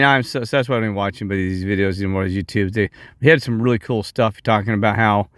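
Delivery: usual speaking voice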